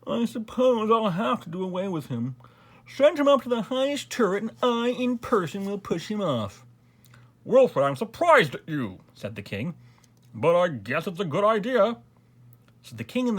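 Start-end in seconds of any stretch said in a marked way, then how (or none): 0.0-6.7 high-pitched, languid noble voice; bored grand duke. A narrator's reading. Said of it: "yawned the Grand Duke Wilfred". 7.5-9.0 louder, higher voice of a reproving king; surprised king. A narrator's reading. King scolds the Grand Duke. 10.3-12.0 king agreeing. A narrator's reading.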